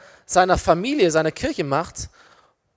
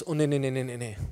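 low, quick, fading string of denials; frightened denial; 7 semitones lower, 9 dB quieter, faster than usual. Acted. Scared Peter asked whether he knows Jesus, timid denial. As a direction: back away from the question, very softly. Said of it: "verängstigten Petrus".

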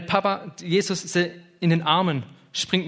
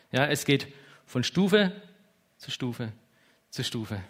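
pausing on each Stufe as a stair going down; step by step. Shown as a demonstration. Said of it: "stepwise delivery".

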